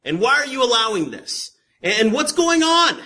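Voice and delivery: high, loud demands, rising in pitch; acted complaint to God. Believer asks why God allows this, loud complaint. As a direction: push each question up toward a shout. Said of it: "and say".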